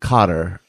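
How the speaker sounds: "Qatar" as COT-er; "Qatar" almost like cutter; offered pronunciation. Shown as a demonstration. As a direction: say "Qatar" with stress up front, COT-er. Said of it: "How do you guys pronounce it?".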